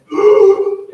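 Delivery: loud sudden gasp for air; sharp gasp. Shown as a demonstration. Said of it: "running out of air, like this".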